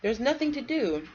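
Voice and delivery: put-on a touch of a bored child's whine; mock whine. Kids complaining to dad, mild mock whine. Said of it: "my kids have whined".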